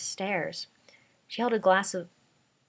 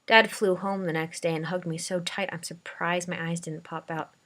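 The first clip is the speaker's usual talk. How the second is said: usual speaking voice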